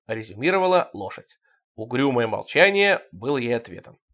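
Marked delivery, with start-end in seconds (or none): none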